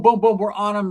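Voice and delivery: ordinary talk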